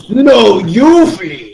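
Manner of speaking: big, loud, slow giant's retort; loud monstrous voice. Acted. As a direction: bellow it slowly like a monster. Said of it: "very, very loud, monstrous voice".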